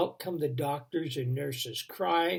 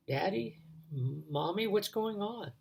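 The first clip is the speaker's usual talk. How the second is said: put-on higher, softer small child voice; frightened child; 5 semitones higher, 5 dB quieter than usual. Boy on the hospital table asking parents, soft scared child voice. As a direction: sound like a scared little boy.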